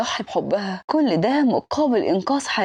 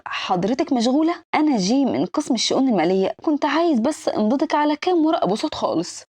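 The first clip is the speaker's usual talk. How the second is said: usual speaking voice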